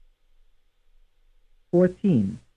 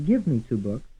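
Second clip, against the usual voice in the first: "Give" raised above the other words; stress on GIVE. Shown as a demonstration. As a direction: lead hard on "Give", ease through "me two books". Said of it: "stressed on the first syllable".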